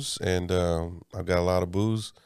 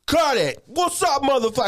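hype MC shout; 12 semitones higher than usual. Retold or imitated. Brit hyping the club crowd, high shout. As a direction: bark it like a hype man grabbing the room. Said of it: "He's all like".

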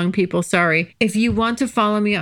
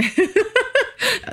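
high laugh. Real, not acted. Laughing at social media banning her.

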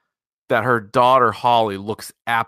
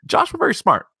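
mock dumb voice; 12 semitones higher than usual. Mocking a dumb character.

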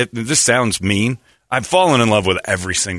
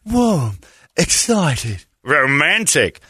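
put-on slow repeating girlfriend; 8 semitones higher, much slower than usual. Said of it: "And she goes".

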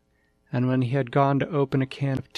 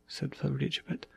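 usual speaking voice

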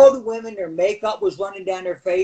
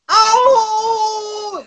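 high, loud cry of pain; 5 dB louder than usual. Retold or imitated. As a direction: yelp out like you've been hit. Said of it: "as loud as I could".

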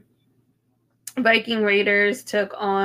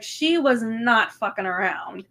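ordinary delivery

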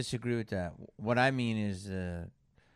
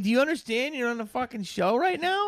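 high, loud incredulous rant; mock outrage at a guest; exasperated scolding; 11 semitones higher, 8 dB louder than usual. Real, not acted. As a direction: tell him off in disbelief. Host scolds guest for noise.

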